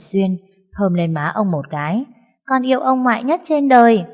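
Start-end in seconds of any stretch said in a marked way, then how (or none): 2.5-4.0 put-on high, sweet child's voice; little girl's voice. Granddaughter tells grandpa she loves him, high child voice. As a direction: beam it out like a happy little girl.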